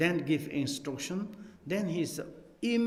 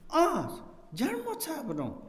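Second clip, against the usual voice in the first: surprised exclamation; 11 semitones higher than usual. Retold or imitated. Host dismayed at monk's answer.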